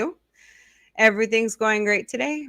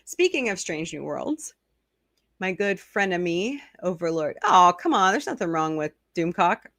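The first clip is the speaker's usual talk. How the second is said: ordinary delivery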